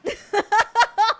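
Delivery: loud high laugh. Real, not acted. Laughs at own joke.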